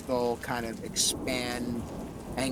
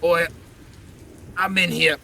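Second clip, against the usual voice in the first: louder and higher, a pained ghostly growl; haunting echoing voice; 5 semitones higher, 8 dB louder than usual. Acted. Werewolf skull demands the party ask quickly, pained and loud. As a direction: push it out loud as a hurting dead thing. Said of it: "In a haunting, ethereal echo".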